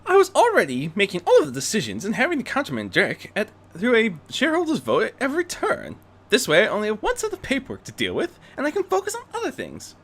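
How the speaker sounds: high, brisk, elegant lady voice; quick, high society lady; Rarity's voice. Acted. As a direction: rattle it off quickly like a busy socialite. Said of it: "As she put it".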